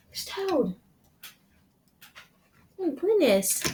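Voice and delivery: ordinary delivery, nothing marked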